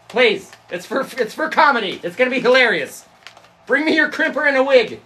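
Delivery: high, insistent call across the house. Real, not acted. Calling Bailey to bring a crimper for comedy, high pleading.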